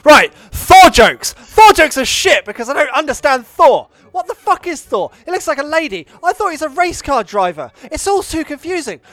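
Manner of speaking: hammed-up exasperated rant, opening loud; angry rant. Podcaster holding the angry stick, mock-angry rant about films. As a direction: hit each complaint as a fresh comic outrage. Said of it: "Angry stick".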